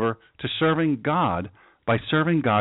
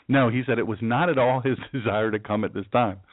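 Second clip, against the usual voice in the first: ordinary talk, nothing marked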